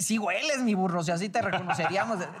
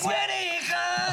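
drawn-out, higher mock [name] voice; [name] impression; 8 semitones higher, much slower than usual. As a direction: stretch out güereja like [name]. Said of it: "estaba imitando a [name] con la güereja". (imitando a [name]: "güereja" alargado y agudo)

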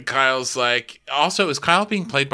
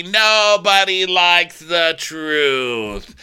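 drawn-out mock verdict; 3 semitones higher, 4 dB louder, much slower than usual. Repeats it slowly.

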